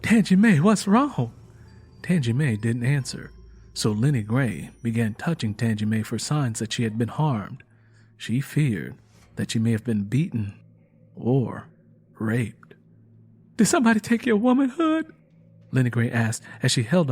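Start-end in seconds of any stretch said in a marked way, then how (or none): 0.0-1.3 higher and louder, panicked; panic-stricken. A narrator's reading. Lenny asking the sobbing Tangy what's wrong, panicked. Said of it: "With a panic-stricken voice". 13.6-15.1 much higher, louder, fast; frantic question. A narrator's reading. Lenny fearing Tangy was harmed, frantic and fast.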